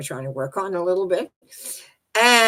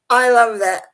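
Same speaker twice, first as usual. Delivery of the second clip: ordinary talk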